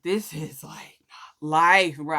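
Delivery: whispering, defeated and drained; unvoiced, worn-out whisper; whispered despair. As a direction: breathe it out like you've given up. Exhausted at wit's end.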